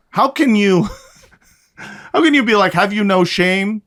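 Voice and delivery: incredulous, high and loud scolding; rising mock indignation, pitched high; mock outrage. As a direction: scold them like a shocked aunt. Mock outrage at anonymous critics.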